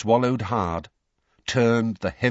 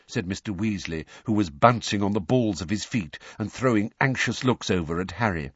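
usual speaking voice

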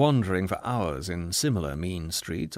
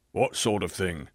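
growl; 4 semitones lower than usual. Acted. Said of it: "growled the other".

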